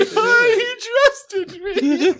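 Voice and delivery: put-on high wailing drunk sob; drunk crying. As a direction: wail it through fake tears. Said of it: "crying in the backseat".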